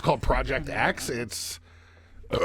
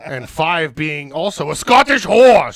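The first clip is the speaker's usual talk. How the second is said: loud punchline; 10 dB louder, slower than usual. Acted. Crude joke punchline, loud.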